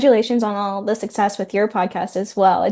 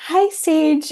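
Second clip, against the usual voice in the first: small child imitation; 7 semitones higher than usual. Voices young niece greeting dog.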